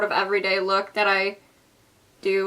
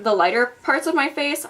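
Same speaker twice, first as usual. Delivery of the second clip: normal talking voice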